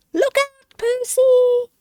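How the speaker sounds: high, loud warning shout; calling out a warning. Acted. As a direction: shout out to warn Percy. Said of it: "the smallest pers-, penguin said".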